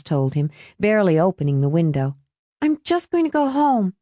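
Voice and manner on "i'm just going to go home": high-pitched excuse to leave; reluctant girl. A narrator's reading. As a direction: back out in a girl's high voice. Girl begs off from date.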